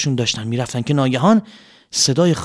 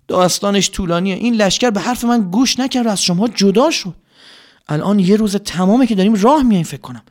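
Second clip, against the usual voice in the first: king speaking; 6 semitones higher, 4 dB louder than usual. Acted. Zulqarnayn tells tired army story.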